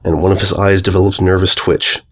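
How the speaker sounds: ordinary delivery, nothing marked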